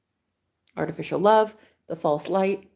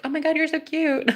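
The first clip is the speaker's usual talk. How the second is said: put-on adoring boyfriend; 8 semitones higher than usual. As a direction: imitate a smitten boyfriend, high-pitched. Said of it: "he's just like".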